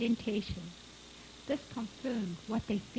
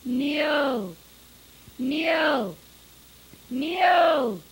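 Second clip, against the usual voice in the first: long falling calls, each louder and higher; calling out; 4 semitones higher, 7 dB louder, much slower than usual. A narrator's reading. Father calling for his son across cliffs, calling out. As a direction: holler a name into the cliffs. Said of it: "calling to Niels".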